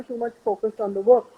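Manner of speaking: usual speaking voice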